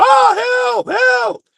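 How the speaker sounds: high-pitched mock scream for help; imitated scream. Man retelling how a woman ran into the street, mock screaming. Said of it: "went on the street screaming".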